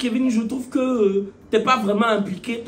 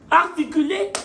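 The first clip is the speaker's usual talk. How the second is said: higher, mock-baffled echo of the word; incrédulité jouée; 6 semitones higher than usual. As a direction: throw the word back, high and incredulous. Réaction outrée à la demande d'articuler. (incrédulité feinte, voix plus aiguë)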